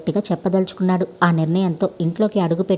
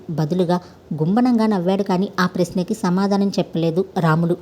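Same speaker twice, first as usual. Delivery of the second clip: plain reading voice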